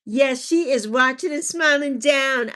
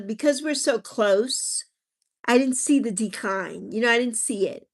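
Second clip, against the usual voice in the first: ordinary talk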